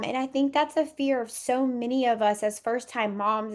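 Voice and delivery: ordinary delivery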